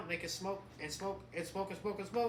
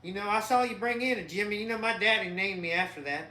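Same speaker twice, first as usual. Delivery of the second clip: put-on light Southern country accent, a little louder; 3 semitones higher, 6 dB louder than usual. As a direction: play a good-ol'-boy Southern mechanic named Jimmy. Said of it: "talks like this".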